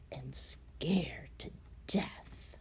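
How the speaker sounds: slow, soft frightened whisper; scared whisper. A narrator's reading. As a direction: breathe it out, scared. Narrator describing fear.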